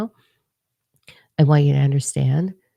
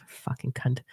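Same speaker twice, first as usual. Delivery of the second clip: under-the-breath whisper; whispered curse under the breath; whispered insult; 2 semitones lower, 11 dB quieter than usual. Real, not acted. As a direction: hiss it aside, barely voiced. Muttered insult aside.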